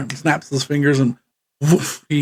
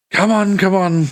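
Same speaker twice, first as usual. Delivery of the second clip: lightly raised, hurrying voice; urging npc; 5 semitones higher than usual. Acted. GM voicing the npc, lightly urging the party up. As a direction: wave them along. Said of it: "And he goes".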